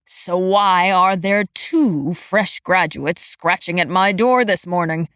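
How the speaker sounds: low, slow exasperated grumble; gruff disbelieving old man; exasperated growl. A narrator's reading. Exasperated supervisor.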